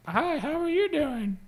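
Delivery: high, slow, squeaky greeting; puppet voice. Pretend. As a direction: play a paper-face puppet. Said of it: "we should just do this and be like".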